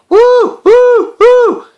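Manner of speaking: very high, loud hooting owl. Acted. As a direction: hoot it out like a night owl. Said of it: "she knew when it was time to wake up and say".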